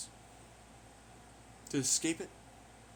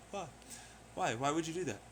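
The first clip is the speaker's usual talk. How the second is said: usual speaking voice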